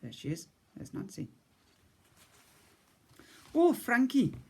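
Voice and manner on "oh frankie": higher, kindly cook voice; spider cook. A narrator's reading. Cook greets the boy, higher motherly voice. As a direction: raise the pitch for the motherly cook. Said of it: "she said".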